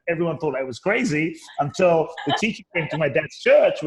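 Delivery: ordinary delivery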